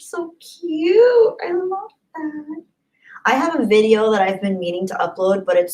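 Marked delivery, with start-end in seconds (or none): 0.0-2.6 high, slow cutesy coo. Real, not acted. Streamer cooing over a viewer's bird photo.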